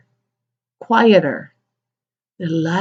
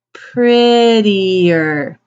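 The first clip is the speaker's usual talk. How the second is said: "Prettier" stretched very slowly; drawn-out model word. Shown as a demonstration. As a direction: stretch out every syllable of "Prettier" for learners.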